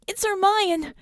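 high-pitched urgent shout. A narrator's reading. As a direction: shout the discovery in alarm. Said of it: "she shouted".

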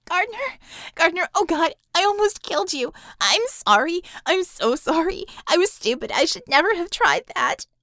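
high, loud, tearful outburst of apology; crying out his name in relief and guilt. Acted. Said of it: "burst into exhausted tears".

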